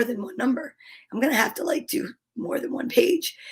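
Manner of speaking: whispered thinking aloud; whispering. Real, not acted. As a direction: whisper it under your breath. Muttered aside while choosing pages.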